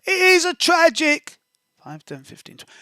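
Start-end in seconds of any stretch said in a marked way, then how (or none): 0.1-1.4 put-on high, tragic little-boy voice; lost child voice. Rogue telling the guard his tragic story, high voice. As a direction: play the mourning orphan. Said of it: "I'm gonna say".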